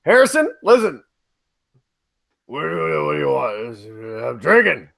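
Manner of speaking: put-on low, slow, slurring drunk; drunk Harrison Ford. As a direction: mumble it like a boozy old actor. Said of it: "where's drunk Harrison at?".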